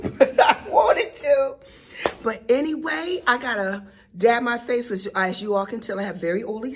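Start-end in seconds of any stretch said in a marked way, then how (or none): 0.0-1.6 laughing admission. Real, not acted. Laughing at herself.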